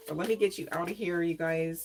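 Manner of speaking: normal talking voice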